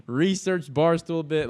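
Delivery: ordinary talk, nothing marked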